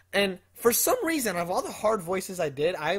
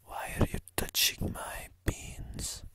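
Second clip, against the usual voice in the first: whispered slow mock voice; 10 dB quieter, slower than usual. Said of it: "Even C says".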